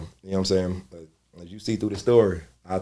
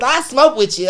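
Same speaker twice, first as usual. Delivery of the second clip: higher, louder, a lightly voiced eager groupie; 9 dB louder than usual. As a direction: tease him like a fan after the show. Groupie offers weed to comedian.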